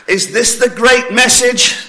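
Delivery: incredulous imagined churchgoer; mock disbelief. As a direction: sceptical scoff at the message. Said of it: "you may be thinking".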